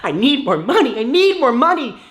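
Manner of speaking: mock greedy chant rising into a loud high squeal; desperate building plea. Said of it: "You're like".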